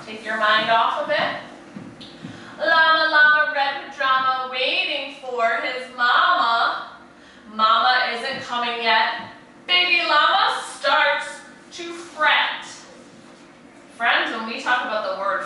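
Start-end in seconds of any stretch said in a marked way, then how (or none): 2.6-12.7 sing-song picture-book rhythm; animated read-aloud to a class; reading to children. A narrator's reading. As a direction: lilt it like a bedtime story for little ones. Reads rhyming picture book aloud.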